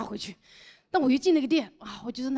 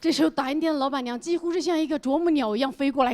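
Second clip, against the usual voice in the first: ordinary delivery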